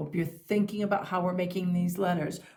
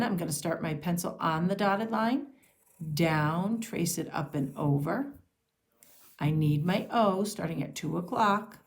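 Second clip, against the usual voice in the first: ordinary delivery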